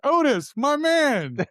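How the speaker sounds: high, loud hollered greeting; scream. Retold or imitated. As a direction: holler it like hailing an old pal. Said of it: "I'd scream".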